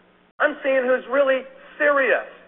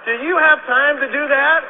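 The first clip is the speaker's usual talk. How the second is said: ordinary talk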